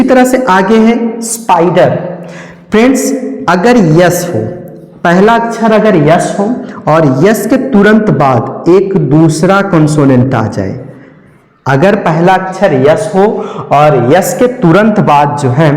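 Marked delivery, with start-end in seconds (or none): none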